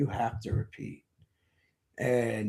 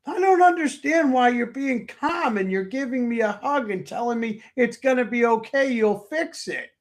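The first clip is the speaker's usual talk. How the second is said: high, louder, amazed wife's voice; disbelieving wife; 12 semitones higher, 5 dB louder than usual. Retold or imitated. Husband voicing his wife, amazed he stays calm, high disbelieving voice. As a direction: pitch way up, unable to believe his calm.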